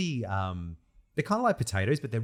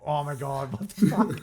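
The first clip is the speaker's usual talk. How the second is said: stunned disbelief; 2 semitones higher than usual. Retold or imitated. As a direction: pitch jumps up on fuck. Voicing the crowd again.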